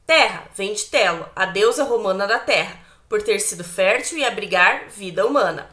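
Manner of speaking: usual speaking voice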